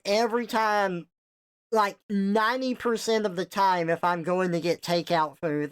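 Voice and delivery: usual speaking voice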